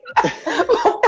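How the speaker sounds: breathy laugh. Real, not acted. Laughing at own joke.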